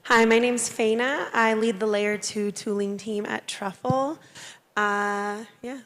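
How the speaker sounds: strained hoarse voice, half gone; hoarse, losing voice. Real, not acted. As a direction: talk through a voice that is going. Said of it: "Sorry, I'm losing my voice".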